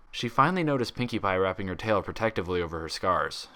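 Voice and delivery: ordinary delivery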